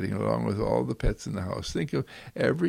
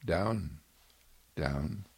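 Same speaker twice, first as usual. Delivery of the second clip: soft command; 6 semitones lower, 5 dB quieter than usual. Pretend. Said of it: "say to a pet".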